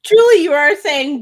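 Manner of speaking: ordinary delivery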